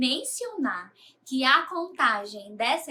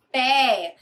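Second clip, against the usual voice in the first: "pé" said louder, on its own; stressed syllable; 5 dB louder than usual. Shown as a demonstration. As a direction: punch out "pé" as the strong syllable. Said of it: "A sílaba mais forte". ("pé" dito com mais força)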